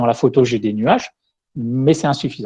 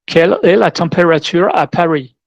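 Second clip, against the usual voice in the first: French spoken with a higher-pitched English accent; 4 semitones higher, 4 dB louder than usual. Shown as a demonstration. Speaker demos voice search to an audience, English-accented French. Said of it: "je vais parler en français avec un accent anglais".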